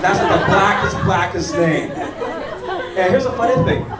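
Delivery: usual speaking voice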